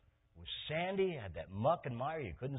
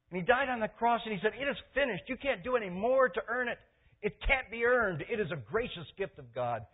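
loud, high, urgent preaching; preaching at full force; 10 semitones higher, 7 dB louder than usual. Real, not acted.

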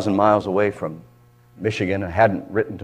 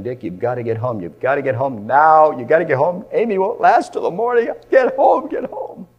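retold screaming plea; 5 semitones higher, 4 dB louder than usual. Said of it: "my wife was screaming and crying".

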